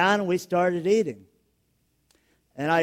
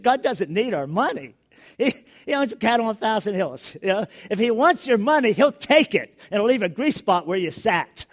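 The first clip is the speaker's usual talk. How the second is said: animated quote; 5 semitones higher, 5 dB louder than usual. Retold or imitated. Quoting speaker, laughing at it.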